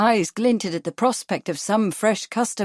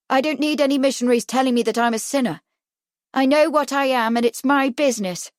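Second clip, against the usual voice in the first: higher, hostile retort; active hostility; 5 semitones higher, 4 dB louder, faster than usual. A narrator's reading. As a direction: push back defiantly at meddlers. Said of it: "turned to an active hostility".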